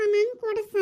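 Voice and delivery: put-on high whiny child voice; begging child. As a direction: plead for it like a small girl. Child begging father for treat. (ಮಗುವಿನ ಹಠದ ಬೇಡಿಕೆ)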